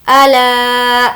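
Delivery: अला with ला held very long; very long laa. Shown as a demonstration. Teacher holding ला to show alif madd. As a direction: stretch the ला of अला. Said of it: "ला को खींचेंगे".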